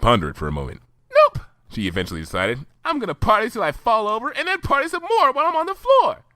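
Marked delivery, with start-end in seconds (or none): 1.1-1.4 very high, loud chirp; Pinkie Pie voice. Acted. 2.8-6.2 very high, giddy pony voice; Pinkie Pie voice. Acted.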